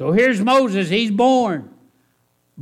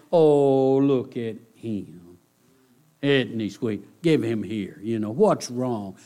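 doting coo; 7 semitones lower than usual. Retold or imitated. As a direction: coo like a woman melting over an infant. Voicing doting woman over baby.

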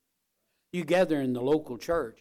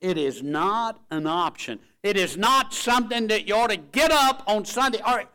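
loud, high, driven pulpit voice; preaching forcefully; 7 semitones higher, 8 dB louder than usual. Real, not acted. Preacher insists church is not an option, preaching forcefully. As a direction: drive the point home from the pulpit.

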